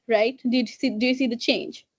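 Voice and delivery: ordinary delivery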